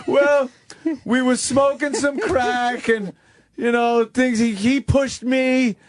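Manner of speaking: high, rushed excuse-making; lightly voiced guy spilling everything; a hint of a nervous confessor; mock blurting suspect.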